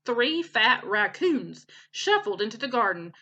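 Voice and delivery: ordinary delivery, nothing marked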